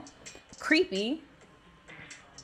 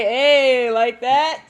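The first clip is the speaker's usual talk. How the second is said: re-enacted whoop, much louder; loud answering cheer; 3 semitones higher, 13 dB louder, much slower than usual. Answering Jamie's hometown call, loud re-enacted cheer. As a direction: holler it back in celebration.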